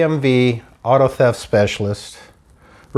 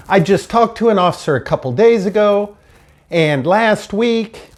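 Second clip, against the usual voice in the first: high insistence; 8 semitones higher than usual. Retold or imitated. Insisting to receptionist, upset.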